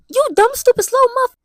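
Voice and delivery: high, loud mocking insult; mock angry insult. Telling off the family who brought her back, high mock insult. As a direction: snap it out high and loud like a fed-up teen. Retold rant at family over ride.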